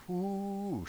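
high, soft amazed whoo; surprised whoo. Real, not acted. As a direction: let out a delighted whoo. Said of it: "reacting to the line".

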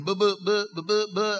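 mock stutter on a repeated "buh". Moses making excuses to God, stuttering. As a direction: stumble over "buh" as if stuck on it. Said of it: "Moses starts stuttering".

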